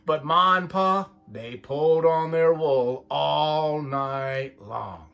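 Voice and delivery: lively picture-book read-aloud, voices for each sheep; reading to children. A narrator's reading. Parent reading a sheep story to the child, lively storytime voice. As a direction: read it like storytime for little ones.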